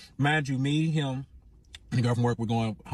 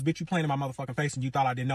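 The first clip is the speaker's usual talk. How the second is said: normal talking voice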